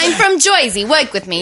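hammed-up Jersey accent, starting high; mock Jersey accent. British actress claiming to be local, mock Jersey accent. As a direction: lay the Jersey vowels on thick. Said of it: "do a Jersey accent".

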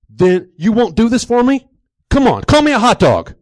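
loud, high, insistent demand; exasperated, worked-up urging; heated pleading. Acted. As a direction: press your friend at a shout. Acted pressing of reluctant friend.

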